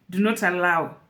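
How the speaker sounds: ordinary talk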